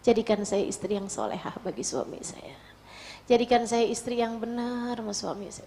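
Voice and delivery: soft, hushed supplication; pleading prayer. Retold or imitated. Struggling wife asks Allah to make her righteous, softly pleading. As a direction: pray quietly, letting the phrases sink.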